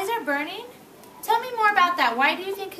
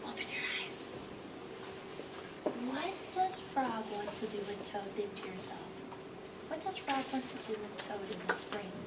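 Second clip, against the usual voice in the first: soft hushed whisper of questions; 6 semitones lower, 17 dB quieter, faster than usual. Real, not acted. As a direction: keep the dreamy hush going. Whispered questions after imagining.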